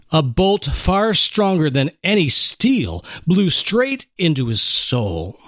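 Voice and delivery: usual speaking voice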